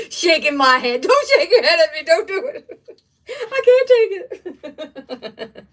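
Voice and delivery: high, loud laughing protest; giggling through the words, slowly at the end; laughing hard. Real, not acted. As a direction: crack up at the comment. Reading chat, laughing hard.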